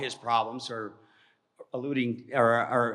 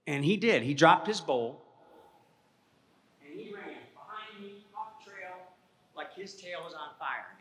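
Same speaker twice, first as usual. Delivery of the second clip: ordinary delivery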